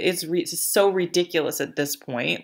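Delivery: usual speaking voice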